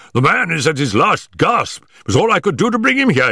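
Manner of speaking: high, agitated outpouring; urgent cry. A narrator's reading. Stackhurst explains the dying man's state, high and urgent. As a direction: blurt it out in alarm, pitch climbing. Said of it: "he cried".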